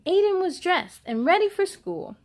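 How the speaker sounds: plain reading voice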